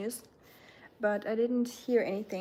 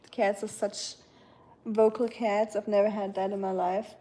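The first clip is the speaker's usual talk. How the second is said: ordinary talk, nothing marked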